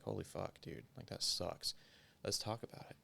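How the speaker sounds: ordinary delivery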